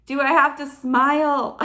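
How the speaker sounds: loud, high, whiny objection; reluctant protest. Retold or imitated. Believer asks God about having to smile, whiny protest.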